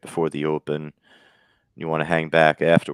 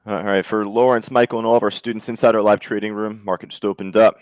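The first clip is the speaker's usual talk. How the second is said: louder, higher live-room announcer; market-open announcement; 4 semitones higher, 6 dB louder than usual. Real, not acted. Host addressing students in the live trading room, announcing loudly.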